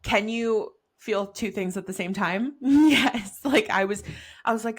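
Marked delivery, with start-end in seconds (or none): none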